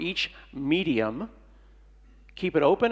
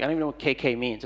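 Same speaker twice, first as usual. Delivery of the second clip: normal talking voice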